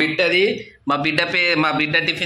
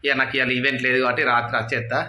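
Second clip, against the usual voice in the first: ordinary talk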